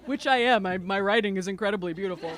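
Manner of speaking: fast self-mocking laugh; laughing at herself. Real, not acted. Laughs at her own emotion.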